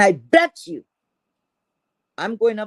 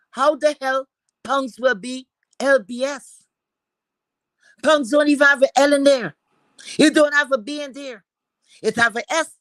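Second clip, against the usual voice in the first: outraged disbelief; 6 semitones higher than usual. Retold or imitated. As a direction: demand it like it makes no sense. Retelling her outrage at LBS.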